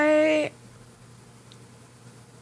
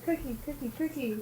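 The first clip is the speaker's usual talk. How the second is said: calling a puppy; 3 semitones higher, 11 dB quieter, faster than usual. Real, not acted.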